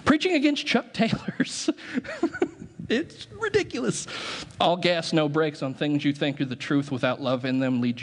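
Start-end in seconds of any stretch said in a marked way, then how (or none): 0.1-4.1 much higher, breaking into laughter; laughing. Real, not acted. Pastor cracking up over a sermon against his favourite shoes, high laughter. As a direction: laugh helplessly at how absurd it is.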